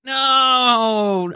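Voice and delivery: long, loud, drawn-out mock wail; mock anguished cry. Friend reacting to co-host liking Iron Man two, mock anguished cry. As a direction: protest in exaggerated horror, loud and stretched.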